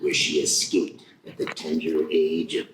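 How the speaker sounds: very low trailer narrator; movie trailer voice. Shown as a demonstration. Asked to narrate her life, deep trailer voice. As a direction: narrate a grave backstory in a deep voice. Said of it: "narration of my life in that voice".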